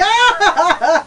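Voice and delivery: loud high laugh bursting out; a sudden squeal of a laugh; high burst of laughter. Real, not acted. Co-host cracks up at his own money joke with a loud high laugh.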